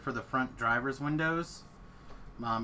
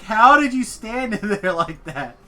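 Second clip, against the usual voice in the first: outraged wife; 7 semitones higher, 10 dB louder than usual. Retold or imitated. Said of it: "She's just like".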